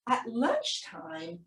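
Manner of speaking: plain reading voice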